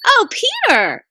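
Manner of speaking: high, slow wondering exclamation; exclaim. Acted. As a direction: gasp his name in wonder. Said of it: "exclaimed Lucy".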